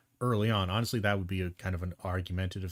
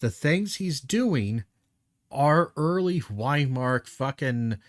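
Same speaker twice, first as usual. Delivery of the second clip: slow, loud, higher angry rant; 4 semitones higher, 5 dB louder, slower than usual. Real, not acted. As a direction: hammer each word out in anger. Ranting rebuttal to debater.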